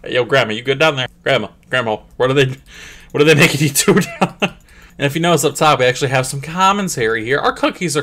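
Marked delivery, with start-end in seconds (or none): none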